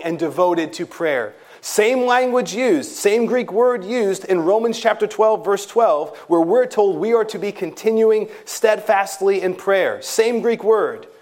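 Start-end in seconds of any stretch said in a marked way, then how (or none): 1.6-11.1 raised, emphatic preaching voice; building sermon emphasis. Acted. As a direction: press each point home from the pulpit. Preaching builds higher and louder.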